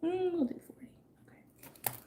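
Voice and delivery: whispered under her breath; whispered count. Real, not acted. Counting out cards, whispered.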